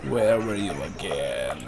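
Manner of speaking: low, slow, drawn-out musing; musing repeat. Real, not acted. Player still searching the map, musing to himself. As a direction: stretch the question out low and slow.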